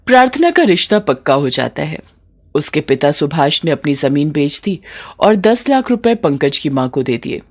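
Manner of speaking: ordinary delivery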